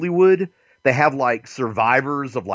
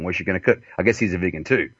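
normal talking voice

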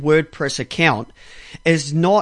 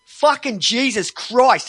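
furious imagined outburst; 7 semitones higher, 6 dB louder than usual. Retold or imitated. Ranting at a chat agent he wants to fly over to, furious. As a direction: let the fury boil over, pitch up. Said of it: "I felt like saying".

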